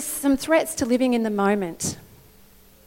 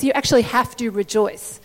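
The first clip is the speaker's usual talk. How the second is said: normal talking voice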